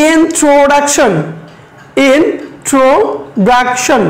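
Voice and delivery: very slow, syllable by syllable; slow teacher demo for students. Shown as a demonstration. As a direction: stretch out each syllable of introduction. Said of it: "look at this word".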